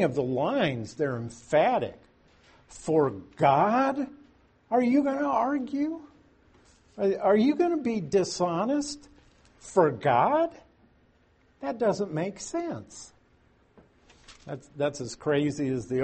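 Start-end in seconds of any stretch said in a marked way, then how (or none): 2.7-13.1 much higher, rising disbelief; incredulous Job. Retold or imitated. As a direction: ask it like it's absurd. Job incredulous at his friends.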